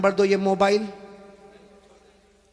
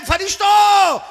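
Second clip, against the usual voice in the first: louder, much higher, ringing out to the angels; booming proclamation; 9 semitones higher, 6 dB louder than usual. Acted. God calling out to the angels, booming proclamation. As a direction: proclaim it to the heavens at full force.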